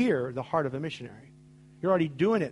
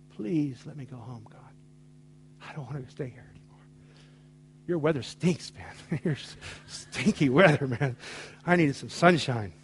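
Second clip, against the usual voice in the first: begging and crying; 3 semitones lower than usual. Retold or imitated. Retold tearful prayer, much softer.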